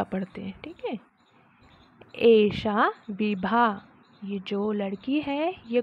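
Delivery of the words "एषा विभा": slow model reading. Shown as a demonstration. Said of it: "teacher reads Sanskrit slowly".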